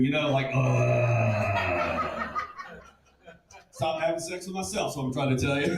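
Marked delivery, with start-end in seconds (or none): 0.5-2.4 long, low monster-like drone; Mad Max villain groan. Acted. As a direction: groan deep and drawn out like a movie villain. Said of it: "looked like the fat bad guy from the Mad Max movie".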